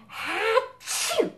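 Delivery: high hammed-up achoo; fake sneeze. Shown as a demonstration. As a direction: sneeze it big and silly. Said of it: "Do the elephant when you sneeze".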